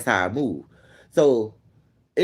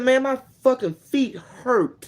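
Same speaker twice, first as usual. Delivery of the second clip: drawn-out complaint; 7 semitones higher, much slower than usual. Retold or imitated.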